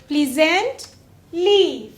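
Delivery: Pleasantly very slow, pause before ली; slow teacherly pleasantly with ली set apart; split before suffix. Shown as a demonstration. Shows word plus suffix.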